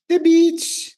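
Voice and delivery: "beach" jumps way up, delighted; excited high exclaim. Acted. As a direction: gush about the beach. Repeating the beach with emphasis.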